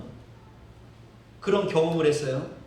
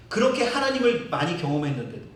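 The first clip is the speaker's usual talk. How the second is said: normal talking voice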